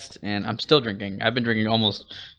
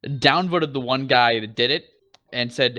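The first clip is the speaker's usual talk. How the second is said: ordinary talk, nothing marked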